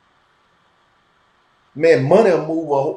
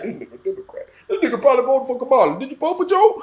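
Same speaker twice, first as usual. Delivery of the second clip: quick, higher mock-suspicious voice; imitating assumers; 8 semitones higher, much faster than usual. Host imitates people who judge his politics, quick and mocking. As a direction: rattle it off like a nosy stranger sizing him up. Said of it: "they would just assume, like".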